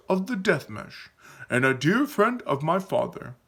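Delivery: grand, proclaiming introduction; Aaron introducing a hero. Acted. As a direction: announce him with reverence.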